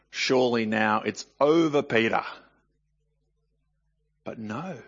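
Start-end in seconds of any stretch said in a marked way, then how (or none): none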